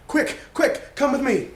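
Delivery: ordinary talk, nothing marked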